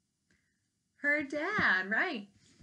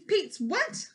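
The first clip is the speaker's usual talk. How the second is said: sharp snap; 9 semitones higher, 5 dB louder than usual. Acted. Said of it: "snapped the queen".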